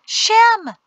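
high, loud stage-whisper cry. A narrator's reading. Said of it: "Jatesy cried in a whisper".

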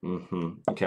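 ordinary delivery

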